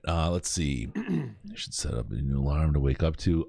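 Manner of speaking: normal talking voice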